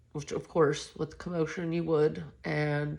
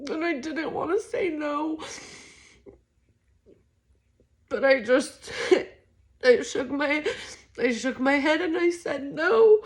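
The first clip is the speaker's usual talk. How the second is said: high tearful voice, near sobbing; words breaking through tears; crying; 10 semitones higher than usual. Real, not acted. Telling her he died.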